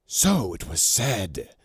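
a hint of a greedy fume; fuming. A narrator's reading. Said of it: "fumed Saramis greedily".

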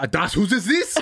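laughing mock German accent; comic German accent with a laugh in it; bad German accent. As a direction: put on a clumsy German accent. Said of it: "That's a bad German accent".

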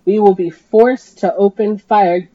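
slow, loud amplified warning; loudspeaker warning. Acted. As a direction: announce it like a megaphone.

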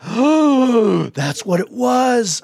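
high, drawn-out dawning realization; retold sudden realization. Retells gasping realization of his mistake.

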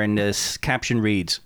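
usual speaking voice

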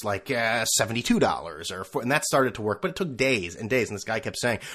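ordinary talk, nothing marked

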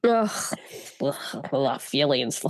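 low, soft groaning about feelings; mock anguish. Real, not acted. Groaning over a sad book.

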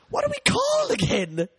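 sudden squeal. Real, not acted. Host suddenly forgets their show's name, shrieking. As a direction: yelp it out as it hits you.

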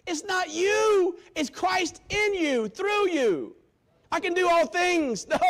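slow, loud, high preaching shout; fiery preacher's cry; full-force preaching. Real, not acted. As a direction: thunder it out to the congregation.

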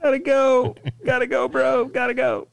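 high, far squeaky voice; squeaky panda. Retold or imitated. Panda fleeing the box, squeaky hurried voice. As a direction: chirp it like a fleeing critter. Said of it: "panda leaving".

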